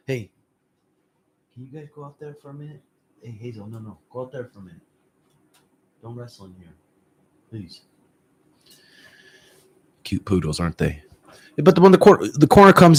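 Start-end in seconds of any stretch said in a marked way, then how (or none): 0.1-7.8 distant, much softer, rushed calls away from the mic; off-mic to the dogs. Real, not acted. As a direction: turn off-mic and quickly shoo the dogs out. Turns away to shoo dogs.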